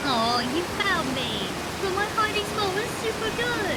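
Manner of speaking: put-on high, bright child calling out; kid character voice. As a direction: call out eagerly like a little kid. Kid character caught in game.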